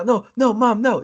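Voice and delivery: high, frantic mock pleading; high-pitched kid. Kid begging mom not to switch it off, mock panic. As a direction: protest like a little kid to a parent. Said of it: "With very high-pitched voice".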